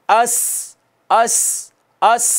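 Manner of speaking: अस with a sharp, hissing स; whistled s. Shown as a demonstration. Teacher shows students the whistling sin. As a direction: let the स whistle in अस. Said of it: "सीटी है".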